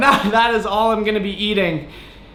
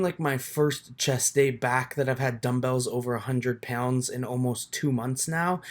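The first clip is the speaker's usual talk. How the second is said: much softer, low-key recorded voiceover; voiceover narration; 7 semitones lower, 8 dB quieter than usual. Real, not acted. Vlogger narrates his chest workout to viewers in a soft voiceover. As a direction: talk over the footage, calm and close to the mic. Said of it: "tips on a voiceover".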